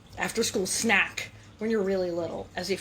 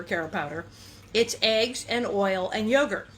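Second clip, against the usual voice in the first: usual speaking voice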